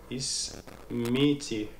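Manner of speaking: slow, drawn-out Ismiti with a long final i; careful teacher's model of Ismiti. Shown as a demonstration. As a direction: say Ismiti slowly, stretching the last i. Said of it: "entre Marocains on dit Smiti".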